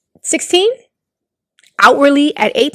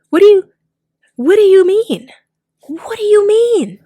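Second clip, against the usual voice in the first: high loud disbelief; outraged, can't believe it; incredulous; 10 semitones higher, 5 dB louder than usual. Real, not acted.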